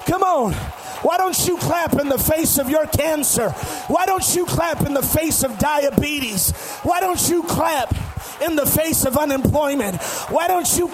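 loud, rallying sermon shout; full-force preaching. Real, not acted. Preacher calling the church to clap for Jesus, full-force preaching. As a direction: rouse the congregation with repeated urgent calls.

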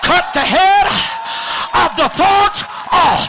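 high, loud preaching; preaching at full force. Real, not acted. Preacher rousing the crowd against the spirit, shouting. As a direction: drive it home.

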